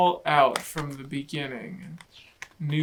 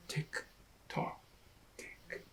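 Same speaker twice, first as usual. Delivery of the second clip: soft ticking; 16 dB quieter than usual. Acted. Clock sound effect.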